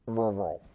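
low car-engine vroom; imitated engine noise. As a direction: make a low engine rev.

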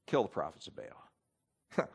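low, soft, slow order; low deadly command. Retold or imitated. Elijah ordering the people to kill Baal's prophets, low. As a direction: drop the voice for a grim decree. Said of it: "And Elijah says".

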